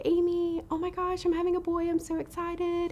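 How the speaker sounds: high-pitched imitation of an excited caller; retold excitement. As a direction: squeal the big news, imitating her, without shouting. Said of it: "and she was so excited".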